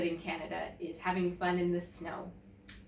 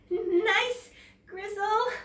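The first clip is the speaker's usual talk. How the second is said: nervous friendly; 12 semitones higher, 5 dB louder, much slower than usual. A narrator's reading. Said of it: "I try to laugh to show we're still friends".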